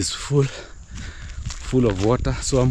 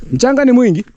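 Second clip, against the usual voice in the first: high, loud call across the yard; calling out loudly; 9 dB louder, faster than usual. Real, not acted. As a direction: shout it out to someone at a distance. Calling out to child outside.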